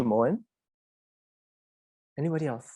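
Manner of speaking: usual speaking voice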